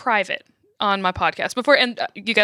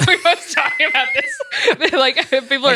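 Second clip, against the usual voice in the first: talking through laughter, pitched up; higher voice broken up by real laughs; laughing; 6 semitones higher, 4 dB louder than usual. Real, not acted. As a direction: laugh your way through the words. Laughing at their own long bickering.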